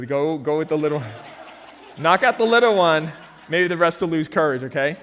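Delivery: laughing. Real, not acted. Pastor laughing as he coaches Francisco to hit the little one. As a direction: chuckle as you coach him.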